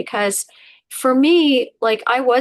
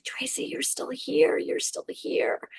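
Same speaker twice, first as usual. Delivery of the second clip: soft, whispered reassurance; tender whisper; 12 semitones higher, 6 dB quieter, slower than usual. Retold or imitated. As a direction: comfort yourself gently, barely voiced. The heart comforting her.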